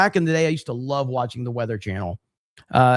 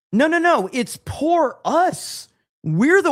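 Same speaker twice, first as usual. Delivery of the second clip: much higher, indignant no no no; high protest; 12 semitones higher, 3 dB louder, slower than usual. Real, not acted. Streamer mock protesting to a viewer, high indignant squeal.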